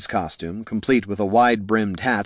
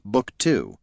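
ordinary delivery, nothing marked

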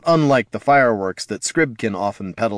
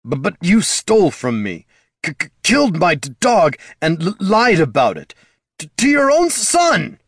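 high, furious, stuttering accusation; wounded rage tripping on consonants; stammering snarl; 8 semitones higher, 4 dB louder than usual. A narrator's reading. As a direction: build to a loud, high outburst. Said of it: "Jin snarled".

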